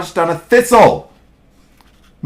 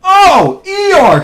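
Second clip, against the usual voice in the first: high, glad exclamation; high storybook greeting; delighted cry; 10 semitones higher than usual. A narrator's reading. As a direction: cry out happily to a friend. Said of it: "cried Pooh".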